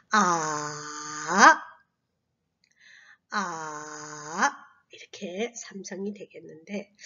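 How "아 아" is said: 아 lower, dipping down then rising; third tone 아. Shown as a demonstration. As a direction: dip 아 to the bottom and lift it again. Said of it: "그러면 삼성은". (낮게 내려갔다 올라가는 3성 아)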